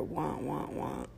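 low, soft, sinking "wah, wah, wah"; imitated droning wah-wah. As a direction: mimic a voice fading into muffled noise. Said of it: "you might just hear".

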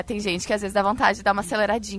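ordinary talk

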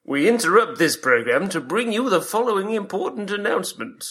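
high, formal mock news announcer. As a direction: cut in like an emergency broadcast. Mock interruption of the show.

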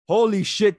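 excited exclamation. Real, not acted. Excited about the Ronaldo transfer.

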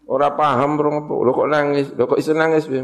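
lively amazed questioning. Real, not acted. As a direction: marvel that they weep without understanding. Amazed anyone cries unknowing.

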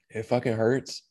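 ordinary talk, nothing marked

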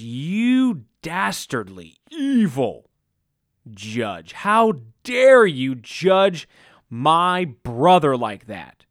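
a family member yelling at the minister, slow and loud; mock outrage. Family member jumps up accusing.

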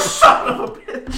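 high, loud, drawn-out mock yell; hammed-up cursing through laughter; retold outburst. Player retelling their reaction to a tough zombie, mock outburst.

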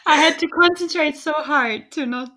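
high, loud, breaking into laughter; real laughter bursting through the words; trying not to laugh. Real, not acted. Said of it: "not start laughing".

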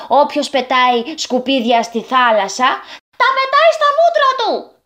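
put-on high, triumphant chanting boys; boys in unison. A narrator's reading. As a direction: chant the moral together. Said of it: "συμπλήρωσαν τα αγόρια".

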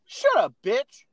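high, loud, drawn-out mock scolding; mock-angry retort. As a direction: stretch it out as a playful snap. Mock comeback to teasing.

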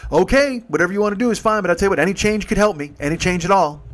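starts high and loud, easing down; insistent stranger. Retold or imitated. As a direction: imitate a man angling for change. Stranger G's insistent pitch, acted.

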